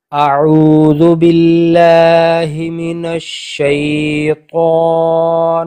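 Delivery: slow chanted بسم اللہ الرحمن الرحیم; Quranic recitation. Shown as a demonstration. Teacher modelling for a student, slow recitation. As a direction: intone it slowly as in tajweed.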